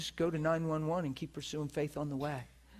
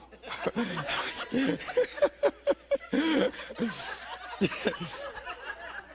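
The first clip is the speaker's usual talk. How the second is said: extended high laughter; sustained burst of laughter; long high laugh; 8 semitones higher than usual. Real, not acted.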